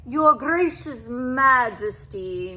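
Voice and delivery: slow, loud purring welcome; a cat purring sweetly to the king. A narrator's reading. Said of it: "he purred".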